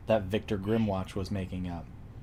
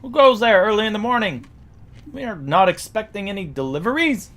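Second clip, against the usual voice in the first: high, loud gate guard challenge; yelling guardsman; 11 semitones higher, 9 dB louder than usual. Acted. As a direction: call out sternly from the gate, voice raised high. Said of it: "some guardsmen yelling out".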